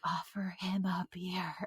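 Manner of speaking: soft unvoiced whisper; whispered inner voice. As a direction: breathe it like a voice inside. This new voice was like.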